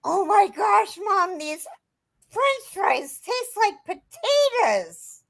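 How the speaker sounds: put-on high, slow, thrilled little girl; excited child. Mother imitating her little daughter's thrilled discovery, high and slow. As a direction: gush it like a delighted kid.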